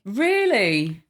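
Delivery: gasp, then an amazed question; real astonishment. Real, not acted. Host learns the executive wrote the story, gasping surprise. Listener hears the story's twist.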